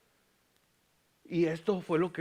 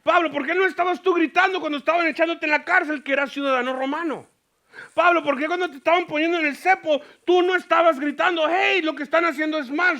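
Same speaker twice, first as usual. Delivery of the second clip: raised, pressing questions, higher and louder; challenging Paul; 9 semitones higher, 8 dB louder than usual. Retold or imitated. Preacher retells challenging Paul in his notes, loud and pressing. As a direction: demand answers from Paul. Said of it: "y yo dije".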